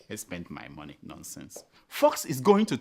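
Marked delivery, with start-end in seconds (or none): 0.1-1.0 scornful repeat, much lower and softer; mocking low mutter. 1.0-1.6 breathy dismissive whisper; whispered scorn. Real, not acted. Scornful dismissal after quote.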